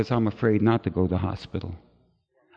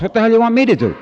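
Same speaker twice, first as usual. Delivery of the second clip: exasperated outburst; 11 semitones higher, 5 dB louder, much faster than usual. Retold or imitated. Exasperated at dead man.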